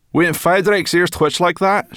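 high, loud chirpy brownie; high brownie voice, talking with her mouth full. Acted. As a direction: pitch her up into a bright little creature. Sorrel voiced.

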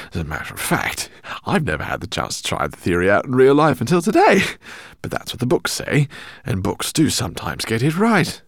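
put-on high, loud, fussy old scholar; eccentric professor. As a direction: voice him as an excitable professor. Professor speaks in story.

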